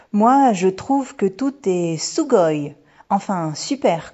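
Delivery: put-on bubbly little girl, stretching the words slowly; bouncy excited girl. A narrator's reading. Bouncy daughter chimes in on the new country, excited.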